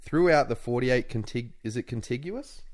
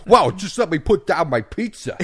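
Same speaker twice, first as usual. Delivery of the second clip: hammed-up New York tough guy, loud and high; shouted comic New York accent; New Yorker accent; 8 semitones higher, 9 dB louder than usual. Host as a New Yorker with pizza, loud mock New York accent. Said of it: "proper New Yorker accent".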